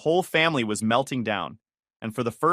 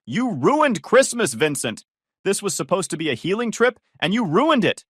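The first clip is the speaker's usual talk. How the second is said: yelling; 9 semitones higher, 5 dB louder than usual. Acted. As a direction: scream the blame at him down the phone. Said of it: "yelling loud enough for the speaker to distort".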